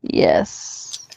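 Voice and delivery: voiceless deadpan whisper; just breathe the yes. Pretend. Co-host whispers yes to leave host hanging.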